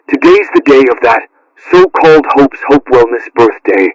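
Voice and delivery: normal talking voice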